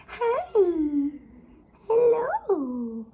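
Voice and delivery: high, loud, drawn-out greeting; adoring coo. Real, not acted. Owner greeting baby animals, high adoring coo.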